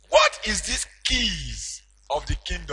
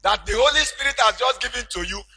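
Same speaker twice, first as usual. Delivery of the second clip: loud, higher preaching surge; preaching at full force; 5 semitones higher, 7 dB louder than usual. Acted. Preacher telling congregation of Peter, in full preaching cry. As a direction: lift the church with it. Preaching climax over music.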